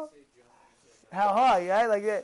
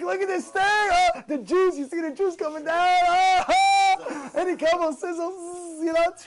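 high, hammed-up food rapture; mock ecstasy, loud oohs; excited; 5 semitones higher, 4 dB louder than usual. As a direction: gush and moan over a juicy steak. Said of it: "you're excited".

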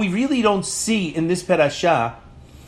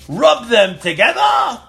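dramatic shout; 5 semitones higher, 5 dB louder, slower than usual. Shown as a demonstration. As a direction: rub them together, loud and slow. Magic trick with the sticks.

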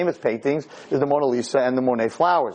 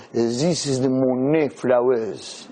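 put-on lightly done French accent; the curator presenting, in a mock French accent; 4 semitones lower, much slower than usual. A curator showing off a painting to tourists, in a French accent. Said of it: "Not bad, my French".

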